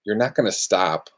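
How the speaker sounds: ordinary talk, nothing marked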